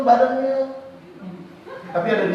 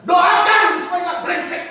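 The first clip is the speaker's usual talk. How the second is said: shout; 6 semitones higher than usual. Real, not acted. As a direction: shout it out to the church. Preacher shouting to congregation. (teriakan khotbah)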